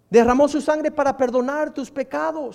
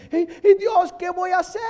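Moses crying out; 6 semitones higher than usual. Retold or imitated. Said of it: "Moisés dice".

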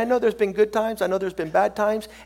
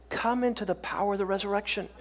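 ordinary talk, nothing marked

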